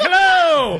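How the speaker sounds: loud, high mock-cheery hello; hollered greeting. Drunk man surprises kids on a dark trail, hollered greeting.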